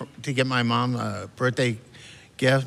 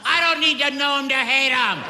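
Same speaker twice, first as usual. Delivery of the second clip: high, fussy indignant retort; high indignant old dad; dad's retort; 13 semitones higher than usual. Retold or imitated. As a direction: snap it back, all offended. Dad answering in story.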